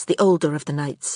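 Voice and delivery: usual speaking voice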